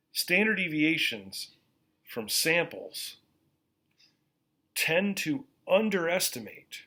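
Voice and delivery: slow, deliberate teacher delivery; slowly. Shown as a demonstration. Teacher to students taking notes, slow and deliberate. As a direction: spell it out word by word, slowly. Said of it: "I'm gonna say this slowly".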